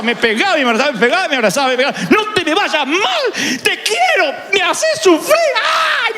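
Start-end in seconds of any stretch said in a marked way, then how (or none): 2.1-6.1 high tearful mock mother, half laughing. Mother hugs her lost son, teary mock mother. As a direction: whine like a relieved mom. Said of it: "la de mi mamá".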